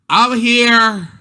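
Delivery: high, loud call back; kid answering. Retold or imitated. Student answering the teacher's roll call, high and loud. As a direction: shout it from across the room, high.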